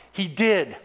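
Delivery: ordinary talk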